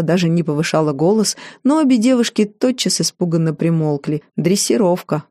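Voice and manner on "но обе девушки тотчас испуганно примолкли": ordinary delivery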